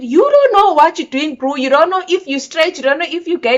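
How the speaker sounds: scolding him hard, voice up. Retold or imitated. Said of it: "I said".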